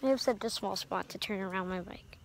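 ordinary talk

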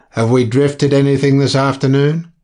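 loud lightly voiced captain; captain calling up, loud; captain from below. A narrator's reading. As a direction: call the question up the stairs. Captain calls up from cabin.

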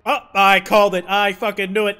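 loud, vindicated shout; emphatic loud told-you-so; triumphant outburst. Real, not acted. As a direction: burst out, loudly proven right. Vindicated as cute act drops.

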